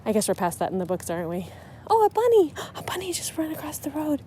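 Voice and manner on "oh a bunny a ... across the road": high, sudden delight at a bunny; light up at the sight; delighted surprise. Real, not acted. Sees a bunny while driving.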